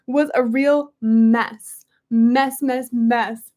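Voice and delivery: mess punched over and over, slow; hammering home. A narrator's reading. Reading aloud, hammering the word mess. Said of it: "hammering home the word repeatedly".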